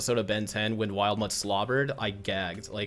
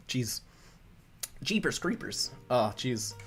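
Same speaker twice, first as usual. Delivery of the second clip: hiccuping; 4 dB quieter, slower than usual. Real, not acted. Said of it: "I'm hiccuping like crazy now".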